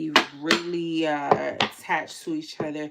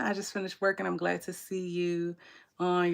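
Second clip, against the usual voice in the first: usual speaking voice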